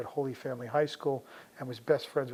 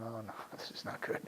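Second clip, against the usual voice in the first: hushed, under-the-breath worry; very soft, half-whispered misgiving; dismayed aside; 3 semitones lower, 12 dB quieter than usual. Retold or imitated. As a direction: imitate a soft, doubtful "oh no" aside. Girlfriend's dismayed aside, shaking head.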